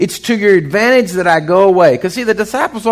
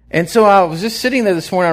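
usual speaking voice

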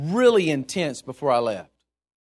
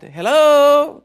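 calling out; 8 semitones higher, 8 dB louder than usual. Pretend. Calling out to God.

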